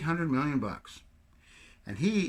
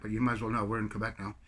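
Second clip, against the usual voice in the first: ordinary delivery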